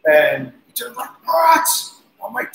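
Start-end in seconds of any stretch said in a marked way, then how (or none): 1.2-1.7 high, loud retold "what"; shouted what. Drummer yelling back to the guitarist: high loud yell. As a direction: yell it back, annoyed at the noise.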